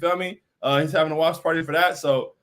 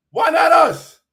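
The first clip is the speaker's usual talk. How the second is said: higher, louder, drawn-out rallying cry; loud hyped call; 9 semitones higher, 9 dB louder, slower than usual. Real, not acted. Streamer rallying Knicks fans, loud hyped call. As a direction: belt it like a rally chant to the fans. Rhetorical hype to chat.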